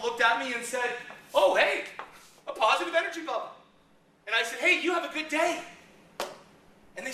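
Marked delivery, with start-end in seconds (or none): 1.3-3.5 much higher and louder, mock surprise; hammed-up pleased surprise; surprised greeting. Negative energy bubble meeting a positive one, high mock surprise. 4.6-5.6 higher, upbeat well-wishing; a bright friendly send-off; cheery greeting. Retold or imitated. Speaker wishing the bubble a good day, cheery and higher.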